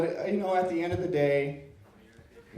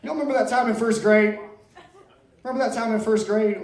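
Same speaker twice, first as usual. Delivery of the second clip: raised and louder, grandly recalling; loud mock nostalgia; 7 semitones higher, 6 dB louder than usual. Comic calling out to the crowd over the guitar, loud and higher. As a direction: boom it out like a reunion toast.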